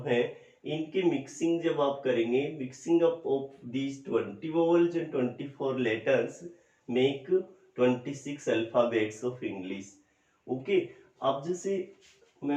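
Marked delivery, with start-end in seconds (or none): 2.6-9.9 very slow, each word set apart; slow dictation. Shown as a demonstration. Teacher to students, dictating the rule slowly. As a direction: dictate it slowly for the class to copy. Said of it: "teacher dictating English summary slowly".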